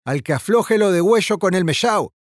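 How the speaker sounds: high-pitched, mildly roared threat. A narrator's reading. Sergeant threatening anyone who slackens, restrained roar. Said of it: "rugía la voz del sargento".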